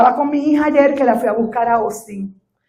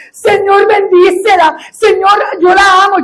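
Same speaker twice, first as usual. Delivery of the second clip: tearful prayer; 9 semitones higher, 8 dB louder than usual. Retold or imitated. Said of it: "empecé a llorar ... y decía".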